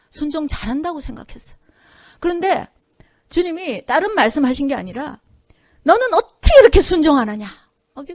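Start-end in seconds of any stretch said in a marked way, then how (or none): 5.8-7.5 loud, raised angry scolding; angry rebuke. Retold or imitated. God angrily scolding the pastor for not obeying, loud and raised. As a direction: let God's anger burst out on every word. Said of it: "막 화를 내시면서 얘기하시는".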